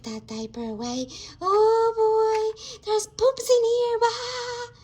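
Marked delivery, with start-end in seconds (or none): 1.4-4.8 high, loud playful wail; mock dismay. Adult playing diaper change finds the mess, mock dismay. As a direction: exclaim it high in mock horror, drawn into a wail.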